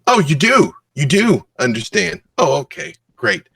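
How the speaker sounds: sarcastic fake cheer; mock surprise. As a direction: toy with him cruelly. Higgins mocking Tommy with knife.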